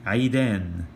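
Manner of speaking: Aidan with a throaty Ain and I sound; Arabic word. Shown as a demonstration. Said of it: "Let's say".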